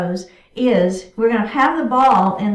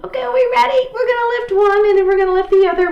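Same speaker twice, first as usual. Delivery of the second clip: high, loud, fast rally; excited pep; 10 semitones higher, 6 dB louder, much faster than usual. Real, not acted. Instructor rallying the class before the toe stand, excited. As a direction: pump the class up.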